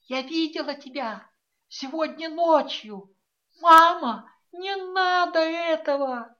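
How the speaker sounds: high, loud desperate pleading; pleading daughter. A narrator's reading. A daughter begs her mother to leave with her, high and pleading. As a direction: beg like a frightened girl. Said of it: "прошептала снова".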